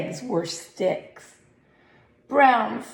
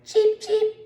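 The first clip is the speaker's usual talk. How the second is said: high little cheeping; baby chick cheep; 13 semitones higher than usual. Acted. Baby turkey chick calling, high cheep. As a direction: peep like a tiny chick. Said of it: "When she was a baby chick".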